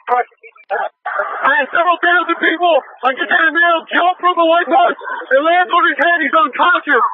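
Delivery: high-pitched, urgent radio report; urgent alarm. Real, not acted. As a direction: pitch rising over the chaos, pressing to be heard. Officer radios crowd chaos alarm.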